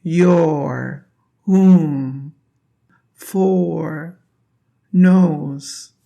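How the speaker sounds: "Your", "whom", "for", "knows" each said apart and leaned on; stressed vowel words. Shown as a demonstration. Teacher having students repeat each word, stressed one by one. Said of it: "Put your hands on your chest and abdomen and repeat".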